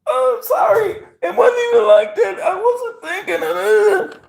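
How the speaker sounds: mock girly apology. Man mimicking girlfriend's excuse in a high falsetto. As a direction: ham up her whiny excuse.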